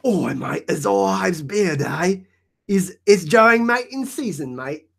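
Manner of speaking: slow, lightly put-on Aussie drawl; a half-hearted fake Australian; lightly voiced Aussie accent; bad Australian accent. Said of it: "That accent was horrific".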